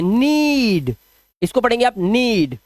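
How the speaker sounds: नीड with the "ee" stretched long; slow, held "ee" in नीड; long vowel demo. Shown as a demonstration. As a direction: draw out the vowel in नीड each time. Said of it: "long sound देना होगा".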